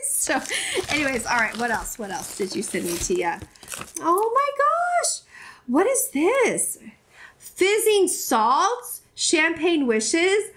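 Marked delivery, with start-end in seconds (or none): 4.0-10.5 high, very drawn-out excitement; amazed delight. Real, not acted. YouTuber unwrapping bath salts from a friend, amazed. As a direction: marvel slowly, pitch up high. Pulls out an unexpected gift.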